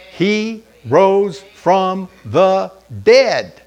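very slow, loud, drawn out; slow proclamation. Acted. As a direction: stretch every word in triumph. Easter proclamation.

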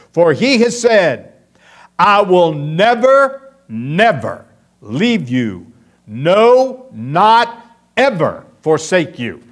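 NEVER, never hammered loud and slow; emphatic stress. Shown as a demonstration. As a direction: pound each negative of the promise. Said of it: "This is the most emphatic statement".

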